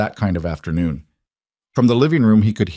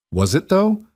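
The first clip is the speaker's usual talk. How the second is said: sharp questioning with a rising tone; challenging; 11 semitones higher than usual. A narrator's reading. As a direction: press her hard, with disbelief. Challenging Vivian's claim of choice.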